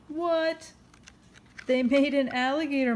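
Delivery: sharp high surprised what. Real, not acted. Mother reading to her son, surprised. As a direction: gasp it out in disbelief.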